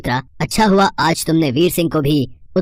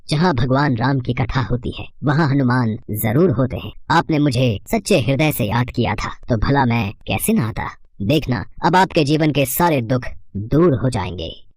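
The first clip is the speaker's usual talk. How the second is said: low, reassuring god voice; benevolent Hanuman; 3 semitones lower than usual. Acted. Hanuman promising the priest relief, low and reassuring. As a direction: reassure the devotee, pitched low and measured.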